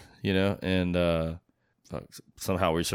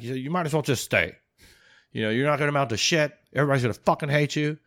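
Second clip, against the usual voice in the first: high, loud, fast berating sergeant major; 8 semitones higher, 4 dB louder than usual. Retold or imitated. Sergeant major telling a soldier leaving the Army he'll fail, loud berating. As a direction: tear into the soldier who thinks he can make it outside. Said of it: "my sergeant major looked at me and he's like".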